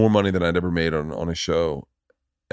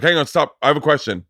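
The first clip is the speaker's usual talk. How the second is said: higher-pitched eager interruption; interrupting; 7 semitones higher, 3 dB louder than usual. Retold or imitated. Rich audience stopping the comic mid-story, eagerly interrupting. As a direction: cut in on the storyteller.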